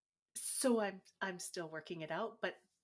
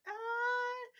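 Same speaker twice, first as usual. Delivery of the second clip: stunned uh; 3 dB louder than usual. Retold or imitated. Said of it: "I was like".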